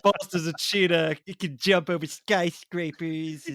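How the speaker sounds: loud, breathless hype; hyped-up exaggeration. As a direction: oversell it like an excited kid. Mocking Grant's hype speech.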